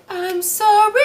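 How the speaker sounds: very high, tiny mock apology; squeaky apology. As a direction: squeak out a sheepish sorry. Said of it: "And I'm like".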